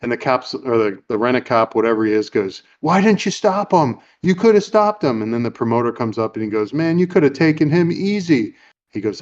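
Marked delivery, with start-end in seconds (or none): 2.8-5.2 high-pitched imitated reproach; mock indignant guard, pitched well up; exasperated scolding. Rent-a-cop to Peter after the thief escapes, exasperated. 6.7-8.5 higher-pitched mock complaint; imitated exasperation. Promoter comes up to Peter after the robbery, exasperated.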